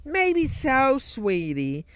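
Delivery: a light put-on Jersey accent, teasing; lightly voiced Jersey girl teasing a lockpicker. Game character teasing the player about the castle door, mild Jersey accent. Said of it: "kinda like this Jersey accent".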